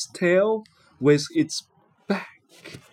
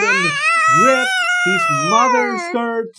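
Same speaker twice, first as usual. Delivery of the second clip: excited narration; 6 dB louder than usual. A narrator's reading. Narrator dramatizes Jack's grab.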